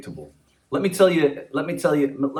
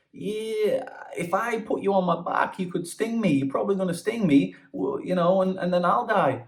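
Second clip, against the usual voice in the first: high, wary frog voice; frog's voice; 9 semitones higher than usual. Acted. Frog refusing the scorpion, in a high wary voice.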